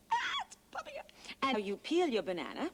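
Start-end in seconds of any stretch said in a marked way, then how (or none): 0.1-1.1 sudden high startled yelp; surprised cry. Real, not acted. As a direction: cry out as it pops. Banana pops up suddenly.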